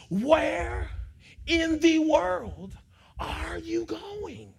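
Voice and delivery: where in the world, stretched out slow; slow emphatic question. Acted. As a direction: drag out where in the world are you going. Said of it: "drawn-out rhetorical question".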